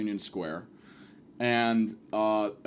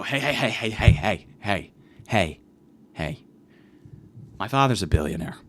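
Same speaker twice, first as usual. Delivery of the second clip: fake billionaire; 5 semitones lower than usual. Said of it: "pretending to be a billionaire".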